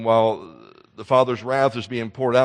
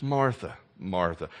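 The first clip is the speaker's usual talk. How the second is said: soft, gentle chiding of a name; tender; 5 semitones lower, 5 dB quieter, slower than usual. Retold or imitated. Jesus gently calls to a worried Martha, softer. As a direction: call her name softly, with love. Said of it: "with a tenderness".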